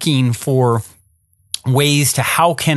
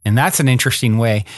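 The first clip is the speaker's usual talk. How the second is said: normal talking voice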